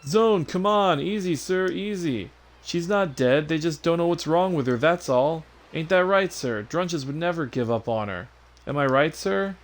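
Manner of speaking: high-pitched, soothing a worked-up friend; calm a comrade down in a high, eager voice; eager sidekick. Acted. Voices game character.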